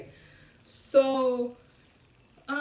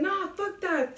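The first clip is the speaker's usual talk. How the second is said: higher-pitched mock defiance; defiant; 7 semitones higher, slower than usual. Brother arguing back in the car, retold as defiant. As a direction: imitate an argumentative refusal.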